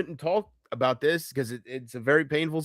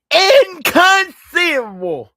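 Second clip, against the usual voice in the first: drawn-out, high and loud cry of disbelief; mock disbelief; 10 dB louder, much slower than usual. To the viewers about the scammers, a hammed-up cry of disbelief. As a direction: stretch it out like a stage outburst. Mock outburst at his own situation.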